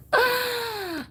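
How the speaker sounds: long, high-pitched sigh; exasperated sigh. Real, not acted. Host worn down by redoing displays, exasperated sigh. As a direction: let out a drawn, high sigh.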